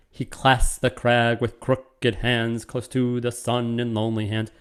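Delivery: slow, beat-by-beat metrical reading; scanning the meter. Shown as a demonstration. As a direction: tap out each iamb slowly. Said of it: "to look at how this could also be done".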